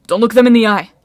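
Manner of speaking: loud, forceful caution; urgent warning. A narrator's reading. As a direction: call out to warn friends. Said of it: "Jason warned".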